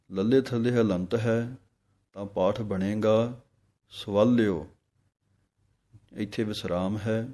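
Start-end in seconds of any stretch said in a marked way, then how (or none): 3.9-4.7 ਸਵਾਲਿਓ with weight on the l sound; firm l in ਸਵਾਲਿਓ; stress on the l. Shown as a demonstration. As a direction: lean on the l of ਸਵਾਲਿਓ. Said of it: "ਲੱਲੇ ਉੱਤੇ ਜ਼ੋਰ ਦੇਣਾ ਹੈ".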